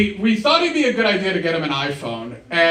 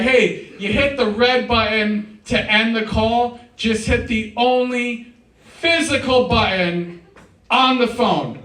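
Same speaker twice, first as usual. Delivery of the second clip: slow explaining; 3 semitones higher, slower than usual. Retold or imitated. Explaining slowly to confused dad.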